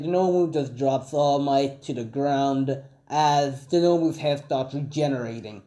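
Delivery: usual speaking voice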